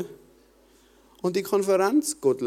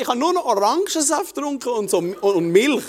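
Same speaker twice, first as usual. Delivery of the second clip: ordinary talk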